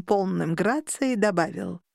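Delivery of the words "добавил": plain reading voice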